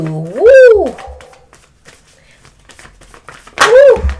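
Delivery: high, loud woo; real thrilled yell; excited whoop. Real, not acted. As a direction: let out a joyful whoop. Ecstatic reaction to tarot card.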